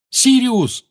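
high, loud desperate call; shouting. A narrator's reading. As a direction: call out into the empty house. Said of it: "крикнул он".

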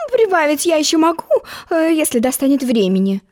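ordinary delivery

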